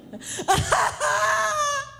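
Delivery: high, choked imitation of a sob; exaggerated bawl catching and cutting out; mock silent cry. Showing the crowd a child's silent cry, mock sobbing. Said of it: "like, the silent cry".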